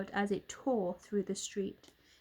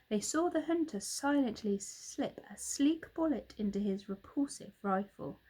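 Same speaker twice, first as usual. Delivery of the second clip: plain reading voice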